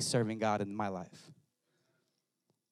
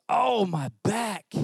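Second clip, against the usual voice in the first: pained groan; 8 semitones higher, 5 dB louder, much slower than usual. Retold or imitated. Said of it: "he goes".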